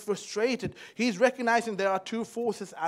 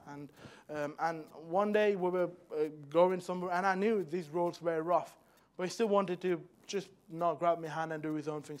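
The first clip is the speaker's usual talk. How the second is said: ordinary talk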